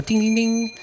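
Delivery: high sung ding-ding chime; door chime. Acted. Player imitates the shop door bell, high chiming.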